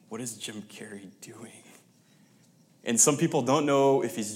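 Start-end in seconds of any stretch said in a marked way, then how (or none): 0.1-1.6 hushed, puzzled whisper of the crowd; whispered murmur. Retold or imitated. Preacher voicing a puzzled crowd, whispered. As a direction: whisper it like a nervous audience member.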